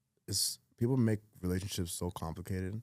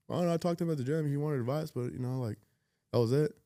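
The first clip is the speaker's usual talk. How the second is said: quick, high, offhand imitation of a friend; higher-pitched, brushing-off friend voice; breezy friend; 6 semitones higher, faster than usual. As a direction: rattle it off like a buddy shrugging it off. Mimicking a dismissive friend.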